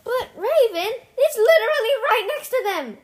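high, loud insistence; exasperated protest. Real, not acted. Telling a friend the octopod is close, exasperated protest. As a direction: argue back, high and emphatic. Argues back at Raven.

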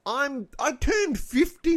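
slow, high mock wail over turning fifty; mock lament. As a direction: drag it out like a tragedy. Dramatic lament about turning fifty.

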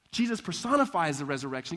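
normal talking voice

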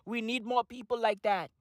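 normal talking voice

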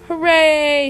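loud, high cheer; joyful shout. A narrator's reading. Crew cheering after passing the storm, loud hooray. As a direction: cheer the ship through. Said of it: "shouts the crew".

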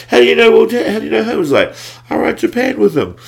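loud, high, excited exchange; excited retelling. Retold or imitated. Retelling the friend's amazed question, a loud high excited exchange. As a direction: fire it off amazed. Mate's shocked question, then answer.

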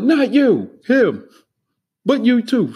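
loud, high retort, still yelling. Retold or imitated. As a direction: shout it across the room, high and loud. Yelling on across the room.

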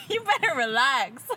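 slow words through laughter; laughing. Real, not acted. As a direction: warn them while cracking up. Banter, cracking up while talking.

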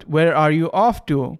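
where are you off to said slowly, word by word; slow teaching read. Shown as a demonstration. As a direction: spell out the question slowly for learners. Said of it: "طبعًا هنا بديت ب where". (قراءة تعليمية بطيئة)

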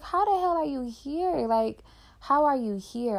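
high, loud, shocked outburst at the sight of him; gasping, how-is-this-possible shock; stunned disbelief. Retold or imitated. Retelling running into the guy outside the store, shocked and high. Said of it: "And I'm looking like".

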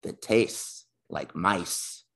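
very slow read question. Real, not acted. Host reading listener question very slowly. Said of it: "I guess I should said".

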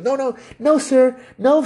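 very high mock-girly voice; feminine voice. Manager answering a customer's request, high feminine voice. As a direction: imitate the manager, pitched right up. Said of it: "feminine voice again".

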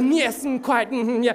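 hammed-up English gentleman accent; posh English impression. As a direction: send up a stuffy Englishman over tea. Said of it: "He was like".

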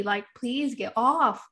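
drawn-out, slow plea; slow pleading. Retold or imitated. To the nursing baby, a slow drawn-out plea. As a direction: stretch each word out, begging. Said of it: "I would literally tell him".

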